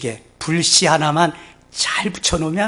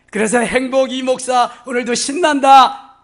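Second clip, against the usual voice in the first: higher, louder joyful cry; exultant shout; 6 semitones higher, 8 dB louder than usual. Real, not acted. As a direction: burst out with delight. Preacher exults at full force. (신나서 크게 외치듯)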